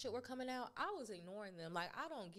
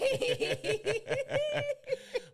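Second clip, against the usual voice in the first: real laughter, much louder and higher; howling laugh at the joke about Pensacola; 13 dB louder than usual. Real, not acted. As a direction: burst into a loud, high cackle. Big shared laugh.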